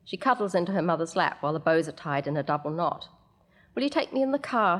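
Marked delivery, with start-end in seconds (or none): 3.7-4.8 put-on high, coaxing little-girl ask; wheedling child. Six-year-old asks her mother for a lift, high and wheedling. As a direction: wheedle it like a child who wants a ride.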